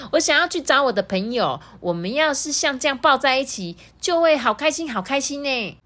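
put-on high, sweet little-kid voice; a small dinosaur child, earnest and innocent; baby triceratops. Baby answers T-rex.

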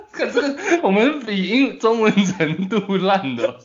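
laughing through the words. Real, not acted. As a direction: giggle out the sentence, high-pitched. Laughing at poor Chinese.

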